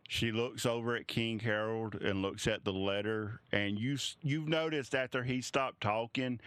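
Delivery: ordinary delivery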